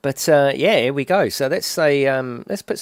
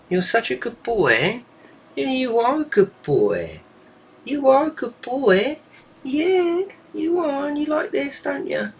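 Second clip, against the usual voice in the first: much higher, slow sing-song; 14 semitones higher, slower than usual. Real, not acted. Owner praising her bird, slow high-pitched baby talk.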